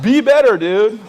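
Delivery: high, loud hammed-up rebuke; exaggerated loud telling-off; mock scolding shout. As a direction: yell it at the kid. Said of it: "and I'm like".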